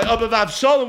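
ordinary talk

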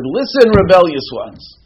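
loud, high, drawn-out rebuke; angry outburst. Acted. Moshe rebuking the people over water, angry shout. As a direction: thunder it at the crowd in acted anger. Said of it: "Moshe Rabbeinu got angry".

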